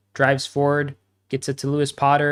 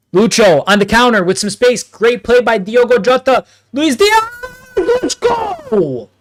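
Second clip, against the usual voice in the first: rising yell, louder and higher; long goal yell; 10 semitones higher, 9 dB louder than usual. Real, not acted. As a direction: scream the goal, building up. Goal celebration on stream.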